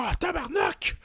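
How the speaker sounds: frustrated shout. Retold or imitated. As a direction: yell it out like a sore loser. Kid swearing after losing marbles.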